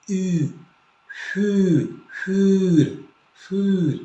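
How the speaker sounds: ऊ, फू, फूर built up sound by sound; फूर as German für; umlaut ü. Shown as a demonstration. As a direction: spell out फूर from its vowel. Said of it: "इसको पढ़ेंगे".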